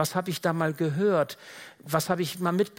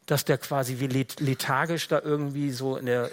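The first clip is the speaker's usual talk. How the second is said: ordinary talk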